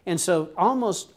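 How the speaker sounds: usual speaking voice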